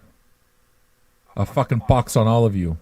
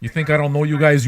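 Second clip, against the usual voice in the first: ordinary delivery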